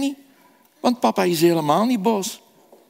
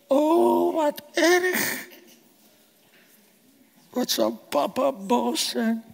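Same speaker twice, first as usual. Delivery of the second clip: put-on dismayed child; 8 semitones higher, slower than usual. Lucas sees the scratch.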